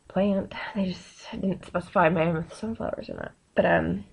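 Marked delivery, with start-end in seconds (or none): 0.7-3.3 soft whispered aside; sly whispered confession; conspiratorial whisper. Real, not acted. Sneaky aside about sunflowers.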